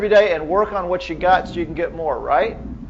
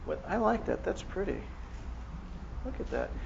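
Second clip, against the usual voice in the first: soft dreamy patient; 15 dB quieter than usual. Retold or imitated. Patient admiring foot in rehab.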